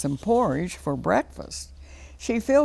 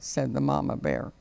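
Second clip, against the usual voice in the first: plain reading voice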